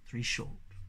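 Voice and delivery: soft whisper; faint echoing whisper. A narrator's reading. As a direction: fade the signal to a whisper. Whistle signal echoed faintly.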